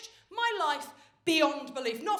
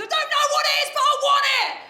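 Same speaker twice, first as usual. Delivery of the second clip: put-on much higher, louder shouted toddler demand; toddler tantrum shout; 14 semitones higher, 16 dB louder than usual. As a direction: yell it like a greedy three-year-old. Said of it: "She said".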